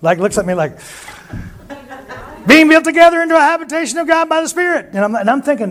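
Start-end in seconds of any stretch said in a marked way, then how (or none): 2.5-4.9 put-on high old prophet reciting the verse; imitating Bob quoting. Bob quotes verse in story.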